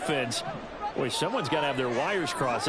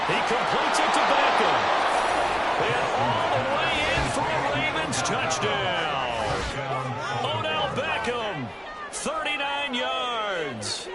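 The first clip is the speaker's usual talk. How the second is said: excited touchdown call; 6 semitones higher than usual. Acted. Commentator calling a Ravens touchdown to viewers, rising excited shout. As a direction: sell the score like the crowd just erupted.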